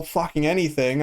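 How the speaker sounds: normal talking voice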